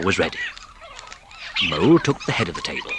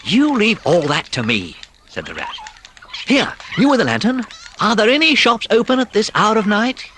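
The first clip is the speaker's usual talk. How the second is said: louder, calm commanding voice; confident Rat; 11 semitones higher, 4 dB louder than usual. A narrator's reading. Rat to Mole then the lantern mouse, confident. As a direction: take charge of the situation.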